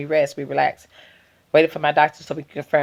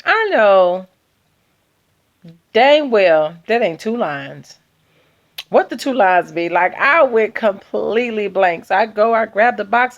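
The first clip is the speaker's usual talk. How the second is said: normal talking voice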